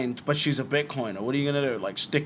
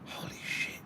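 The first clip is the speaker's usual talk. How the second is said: whispered holy shit, under the breath; 15 dB quieter than usual. Real, not acted. Repeats it under his breath.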